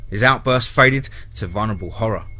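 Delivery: usual speaking voice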